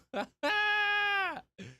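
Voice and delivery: a sudden laugh that shoots way up high; real high cackle of disbelief; high squealing laugh. Real, not acted. Reacting to biscuits-and-gravy cereal question.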